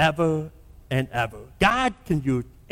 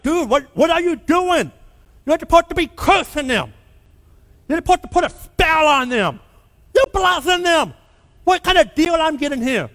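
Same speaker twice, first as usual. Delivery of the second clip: higher, exasperated outburst at Balaam; mad king; 9 semitones higher than usual. Retold or imitated. King Balak scolding Balaam for blessing, mad higher voice. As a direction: play the furious king who got blessings instead of curses. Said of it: "He's mad".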